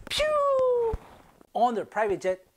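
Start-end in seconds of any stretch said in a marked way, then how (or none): 0.1-1.0 high whoosh sound. Pretend. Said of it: "they'll be like".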